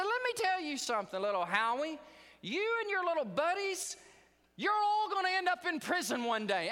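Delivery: high, loud scolding schoolteacher; angry teacher. Retold or imitated. Teacher scolding little Howie, high loud and shrill. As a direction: shriek it like a furious teacher. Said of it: "And she said".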